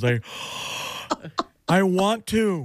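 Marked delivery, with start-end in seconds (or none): 0.2-2.7 big gasp, then slow high-pitched yearning; hammed-up thrilled gasp and drawn-out words; mock excited. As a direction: imitate someone open-mouthed with excitement. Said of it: "He was so excited".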